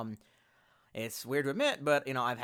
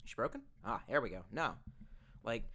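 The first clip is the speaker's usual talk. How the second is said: normal talking voice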